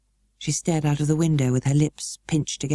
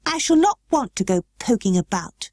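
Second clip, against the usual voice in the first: sulky, pitched-up retort; sour; 6 semitones higher than usual. A narrator's reading. Mary snapping back at the housekeeper, sour. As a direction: answer back sourly like a sulky child. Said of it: "said sour little Mary".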